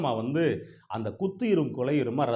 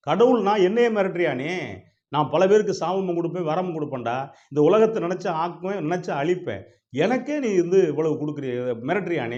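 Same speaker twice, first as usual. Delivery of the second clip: raised, forceful acted outrage; indignant anger; 4 semitones higher, 5 dB louder than usual. God asks who dares threaten him, loudly indignant. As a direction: assert your power, high and affronted.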